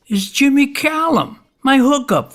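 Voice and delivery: stunned, pitch leaping up as the name lands; surprised recognition. Acted. Narrator stunned to find his old hookup at the door. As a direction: voice jumps high in shock at who's there. Neighbor's door opens on Jimmy.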